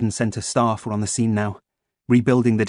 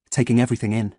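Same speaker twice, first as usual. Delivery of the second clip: usual speaking voice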